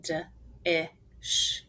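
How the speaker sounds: "D-I-sh" as three isolated sounds; short separate sounds with gaps between; sounded out. Shown as a demonstration. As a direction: sound out dish one phoneme at a time. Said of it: "Let's finger spell".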